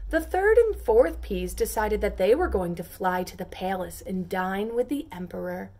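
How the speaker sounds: ordinary delivery, nothing marked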